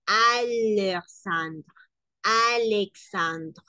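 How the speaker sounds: "Alexandre" stretched out slowly, L clear. Shown as a demonstration. Teacher slowly models "Alexandre". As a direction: draw out "Alexandre" syllable by syllable. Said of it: "es el sonido de la L".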